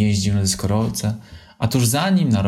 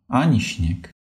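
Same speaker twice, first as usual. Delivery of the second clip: ordinary delivery, nothing marked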